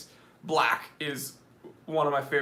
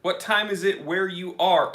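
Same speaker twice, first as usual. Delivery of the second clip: usual speaking voice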